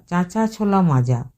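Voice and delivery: plain reading voice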